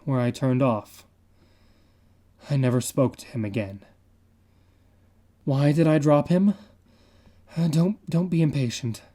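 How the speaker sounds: ordinary delivery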